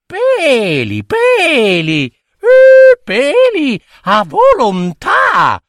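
put-on very high, loud shrieking child taunt; loud high teasing squeal. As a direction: shriek it with glee.

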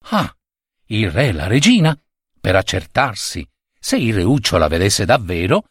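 ordinary delivery

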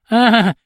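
acted yawn of an old man; yawning exclamation. A narrator's reading. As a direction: let it out through a yawn. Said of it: "esclamò Ciancarella con uno sbadiglio".

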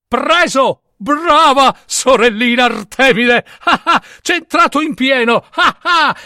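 a god laughing at his sister's mistake; gloating laugh. Acted. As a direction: cackle with glee at the trick. Apollo gloats as arrow hits.

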